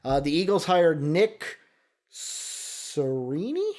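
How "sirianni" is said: Sirianni said slowly as sir-ee-AH-nee with a questioning rise; unsure pronunciation. Real, not acted. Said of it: "I hope I said that right".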